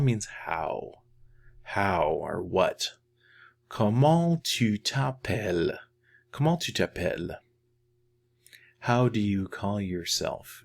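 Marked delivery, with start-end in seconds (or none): 3.7-5.8 higher, drawn-out "Comment tu t'appelles"; slow model phrase. Shown as a demonstration. Teacher models asking someone's name, slow careful French. 6.3-7.4 quick, connected "Comment tu t'appelles"; natural-speed repeat. Shown as a demonstration. Teacher repeats the question for learners, quick fluent French.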